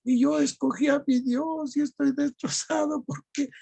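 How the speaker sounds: high, pained retelling of heartbreak; mock crying, high-pitched; imitated tearful voice. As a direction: act out a brother crying, pitched well up. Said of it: "llorando, me dice".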